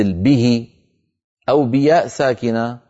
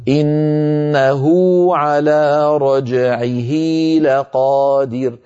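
slow chanted tajweed recitation; Quran recitation; much slower than usual. Shown as a demonstration. Teacher reciting the verse to students: slow Quranic chant. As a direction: recite it, drawing out each vowel. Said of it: "مثاله من كتاب الله".

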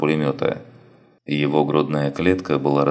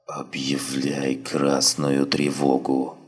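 weak, slow, faint dying voice; dying wounded man; 2 semitones lower, 3 dB quieter, much slower than usual. Acted. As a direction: barely get the words out, fading.

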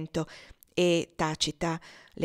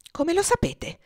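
sharp, higher-pitched stern demand; 13 semitones higher, 4 dB louder than usual. A narrator's reading. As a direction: snap the question at her daughter. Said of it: "con piglio severo". (domanda severa)

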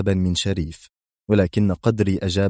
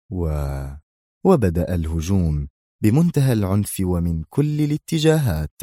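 usual speaking voice

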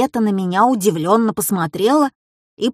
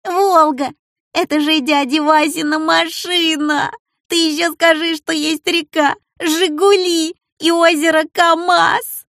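laughing; 7 semitones higher than usual. Acted. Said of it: "повалился на пол и схватился за живот".

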